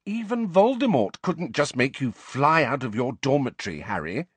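high, emphatic, exasperated lecture. A narrator's reading. Hermione explaining Hogwarts rules to Harry, exasperated. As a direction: spell it out in exasperation, leaning on key words. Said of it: "sounding thoroughly exasperated".

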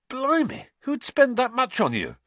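loud, high-pitched astonishment; amazed. A narrator's reading. Ron marvelling at the expensive gift, loudly amazed. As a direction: exclaim it high and loud in disbelief.

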